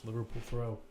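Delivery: ordinary talk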